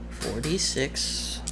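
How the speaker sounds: normal talking voice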